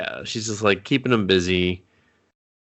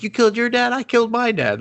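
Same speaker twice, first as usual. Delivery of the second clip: higher, eager voices; excited mock kids; 13 semitones higher, faster than usual. Teens bonding over killing their dads, excited and higher. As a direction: gush like kids finding a friend. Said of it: "They're like".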